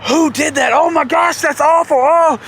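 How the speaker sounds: high, hammed-up outrage at a smell; feigned disgust. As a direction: act appalled so nobody suspects you. Feigns disgust to hide guilt.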